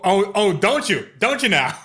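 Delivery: high, loud sarcastic oh; mock disbelief. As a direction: scoff at the cashier, disbelieving. Customer mocking cashier.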